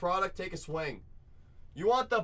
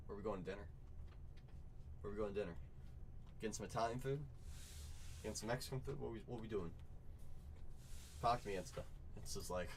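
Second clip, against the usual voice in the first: normal talking voice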